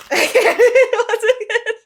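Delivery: high burst of laughter; laughing. Real, not acted. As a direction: laugh it out loud. Laughing while shuffling cards.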